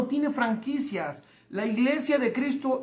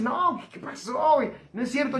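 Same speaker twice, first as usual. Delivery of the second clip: a hint of a scoffing Mexican friend; mildly mock-incredulous objector; skeptical listener. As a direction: lightly voice a doubter saying no way. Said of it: "alguien va a decir".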